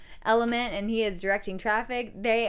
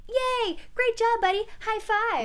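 friendly buddy; 12 semitones higher than usual. Retold or imitated. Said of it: "it wasn't like a friendly".

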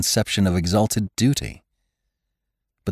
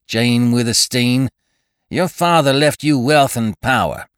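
raised, domineering scolding; an elder rebuking Jane, domineering; 6 semitones higher than usual. A narrator's reading. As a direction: lay down the law to her. Tull lectures Jane.

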